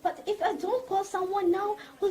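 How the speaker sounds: ordinary delivery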